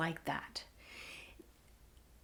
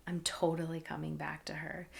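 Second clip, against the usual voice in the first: breathy hushed aside to herself; unvoiced awed whisper; whispered thought; 4 semitones lower, 10 dB quieter, faster than usual. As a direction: murmur it under your breath in awe. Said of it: "I thought".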